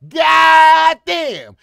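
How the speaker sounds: loud drawn-out goddamn yell; exasperated shout. Real, not acted. Reacting to the clip, a loud exasperated goddamn. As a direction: shout it in disbelief.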